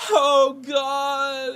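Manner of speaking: high moaning cry of oh God; mock moan; imitating a passionate moan. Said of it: "it sounded like she was screaming".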